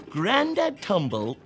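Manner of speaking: ordinary delivery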